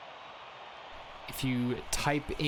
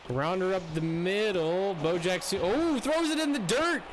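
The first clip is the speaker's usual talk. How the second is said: live play-by-play; 5 semitones higher than usual. Acted. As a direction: call the hit like a broadcaster.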